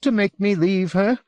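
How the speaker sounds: high, anxious accusing question; agitated man smelling a trick; suspicious. A narrator's reading. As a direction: challenge him in a high, mistrustful voice. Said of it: "studying Will with some suspicion".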